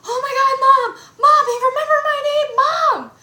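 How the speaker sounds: loud, high, rapid fangirl squeal; freaking out. Retold or imitated. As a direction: re-enact yelling to Mom in total excitement. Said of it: "I started freaking out".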